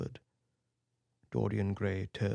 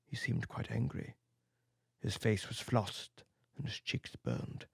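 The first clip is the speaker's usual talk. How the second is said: usual speaking voice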